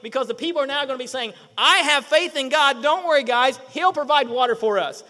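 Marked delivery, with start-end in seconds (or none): 1.6-5.0 loud, emphatic show of faith; mock confident declaration. Preacher voices what people should be saying, loudly confident. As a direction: proclaim it like an unshakeable believer.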